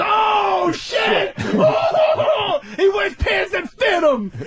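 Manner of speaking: high, hammed-up hooting yell; mock jeering shout. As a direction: crow it over the crowd. Imitated crowd jeering.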